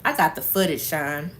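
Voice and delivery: ordinary delivery, nothing marked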